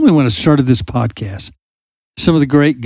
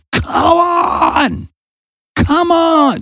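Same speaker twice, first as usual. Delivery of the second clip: imitating Jesus shouting over the storm; shouting across the storm; much slower than usual. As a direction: call him out of the boat, much higher. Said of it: "Jesus was shouting at Peter".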